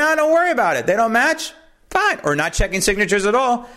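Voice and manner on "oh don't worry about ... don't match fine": high, breezy mimicry; mock careless official. Host mimicking officials waving off bad signatures, breezy and high. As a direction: toss it off like a careless clerk.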